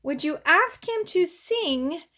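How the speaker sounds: lively, singsong picture-book read-aloud; reading to children. Acted. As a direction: read it to a little one, bouncing the rhymes.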